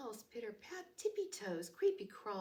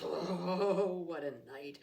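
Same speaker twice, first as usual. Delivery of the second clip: muttering mole; 3 semitones lower, 4 dB louder than usual. Acted. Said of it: "Mole mutters".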